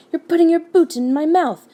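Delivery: high, exasperated protest; a touch of comic outrage; bellowed. A narrator's reading. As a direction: moderate bellow of annoyance. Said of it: "bellowed Trumpkin".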